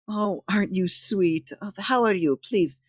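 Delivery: put-on high, soft cooing as if to a child; condescending coddling. Devotee treating a visitor like a child, coddling. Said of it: "almost like a child".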